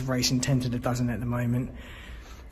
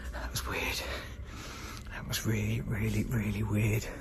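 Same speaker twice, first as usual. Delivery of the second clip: whispering; 3 semitones lower, 6 dB quieter than usual. Real, not acted. After hearing a strange noise.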